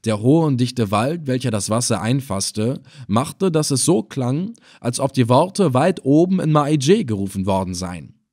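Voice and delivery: ordinary delivery, nothing marked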